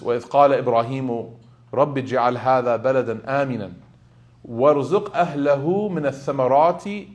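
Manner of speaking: fast, fluent Arabic verse recitation; Quran recitation. Acted. Preacher closing the sermon with a recited Quran verse.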